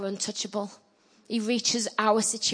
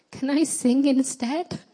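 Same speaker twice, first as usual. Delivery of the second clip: high, slow, drawn-out meek plea; pathetic little voice; 7 semitones higher, slower than usual. Retold or imitated. Nervous singer asking the preacher to let her off, pathetic little voice. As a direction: plead in a small pitiful voice, pitch lifting at the end. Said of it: "in a very pathetic little voice".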